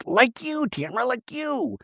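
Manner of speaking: high, soft mock whisper; hushed, high-pitched needling; half-whispered jab. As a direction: imitate someone piping up under her breath. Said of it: "Shannon kind of whispers".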